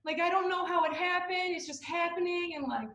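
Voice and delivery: high, wobbly mock crying apology; imitating herself sobbing to her mother; retold bawling. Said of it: "I just started bawling".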